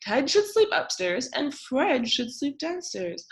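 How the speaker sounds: high, fast little bird. A narrator's reading. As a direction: chirp it out brightly like a bird. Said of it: "said the bird".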